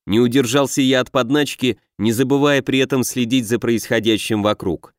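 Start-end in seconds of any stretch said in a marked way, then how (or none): none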